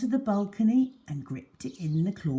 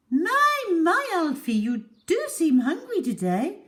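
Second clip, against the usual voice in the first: high, loud doting lady's voice; gushing high-pitched pet talk; Mrs Silver cooing; 10 semitones higher, 5 dB louder than usual. A narrator's reading. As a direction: coo at her pet like a doting owner. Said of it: "Missus Silver was saying".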